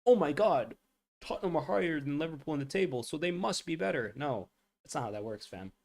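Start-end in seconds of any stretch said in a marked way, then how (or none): 0.0-4.1 high, fast mocking voice of a naive fan; mock the gullible fan; sarcastic high fan impression. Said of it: "it's just stupid to be like".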